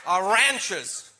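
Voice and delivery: RANCHERS drawn out slowly with an American flat A; put-on American "ranchers", stretched and relished; American accent. Shown as a demonstration. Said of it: "say it in an American accent".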